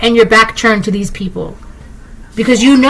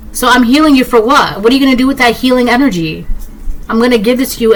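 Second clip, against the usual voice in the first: ordinary talk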